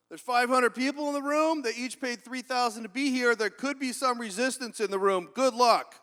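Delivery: loud, high, forceful imitation of the boss; booming warning. Ron warning the nervous speaker about the room, booming. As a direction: belt it out as a blunt warning.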